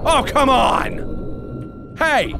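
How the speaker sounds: exasperated. Real, not acted.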